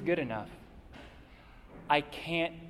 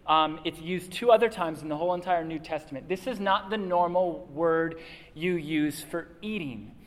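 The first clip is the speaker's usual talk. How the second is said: ordinary talk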